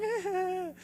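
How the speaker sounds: high mock coo; mock soothing sound. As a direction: coo it like a mom rescuing her kid. Imitating mom's comforting noise.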